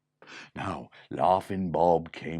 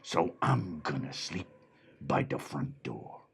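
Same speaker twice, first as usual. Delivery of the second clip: gruff watchdog; 8 semitones lower than usual. Acted. The dog says he will guard the door, low. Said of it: "And the dog said".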